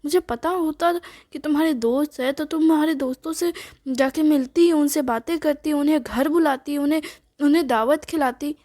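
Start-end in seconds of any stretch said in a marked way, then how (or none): none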